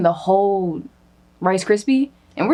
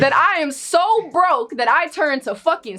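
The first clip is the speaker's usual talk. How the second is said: indignant outrage; 6 semitones higher than usual. Real, not acted. Indignant rant about disrespect.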